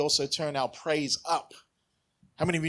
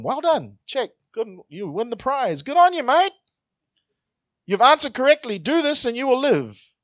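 hearty praise; 8 semitones higher, 5 dB louder than usual. Retold or imitated. Jesus praising the lawyer's answer.

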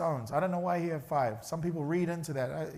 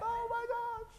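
mock spooked; 7 dB quieter, slower than usual.

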